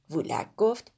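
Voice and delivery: plain reading voice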